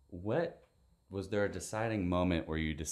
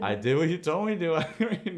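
laughing quote; 9 semitones higher, 4 dB louder than usual. Retold or imitated. Retold reply, laughing.

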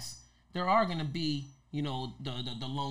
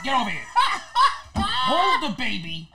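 imitating Rick; 9 semitones higher, 9 dB louder than usual. Actor imitates Rick bossing him around, loud and high. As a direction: command it like the uncle in charge. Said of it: "That's him".

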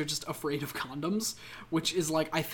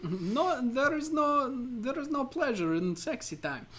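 put-on raised Borat accent, no stretched; joking back in a Borat voice; mock Borat; 7 semitones higher than usual. Cohost answering in mock Borat accent.

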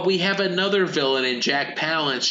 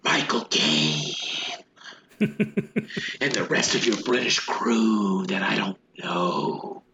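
low, slow, breathy villain drawl; breathless Jack Palance; 6 semitones lower, slower than usual. Retold or imitated. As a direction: lean in like a breathless old menace, lower and slower. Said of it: "Jack Palance is doing that breathless".

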